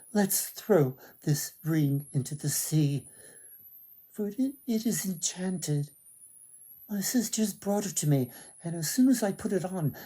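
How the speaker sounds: a maiden rushing to warn of the spell; frightened young maiden. Acted. Maiden Mersina voiced in the tale.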